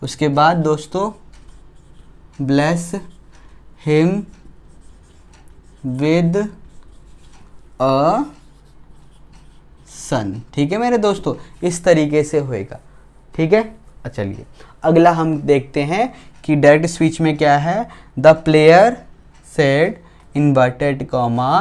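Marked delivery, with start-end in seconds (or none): 2.5-10.3 word by word with long pauses; slow dictation. Real, not acted. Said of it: "मैं slow slow बोलूँगा". 18.2-19.9 very slow, spaced-out reading; slow dictation. Real, not acted. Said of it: "मैं slow slow बोलूँगा".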